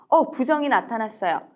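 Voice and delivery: normal talking voice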